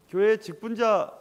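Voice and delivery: usual speaking voice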